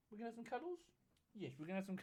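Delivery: very soft murmur to a pet; hushed, affectionate aside to a pet; doting dog talk. Real, not acted. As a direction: coo quietly to the dog about cuddles. Talking fondly to the dog.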